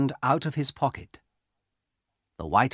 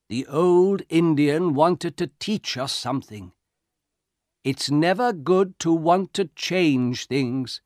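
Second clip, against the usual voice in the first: put-on old soldier, earnest; 6 semitones higher, 4 dB louder than usual. A narrator's reading.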